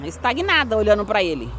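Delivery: ordinary talk, nothing marked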